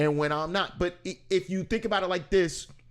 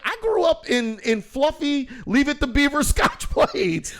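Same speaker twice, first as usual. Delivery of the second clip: high, loud, laughing protest; 10 semitones higher, 6 dB louder than usual. Retold or imitated. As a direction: protest while cracking up. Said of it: "I'm like".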